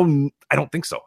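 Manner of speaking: ordinary talk, nothing marked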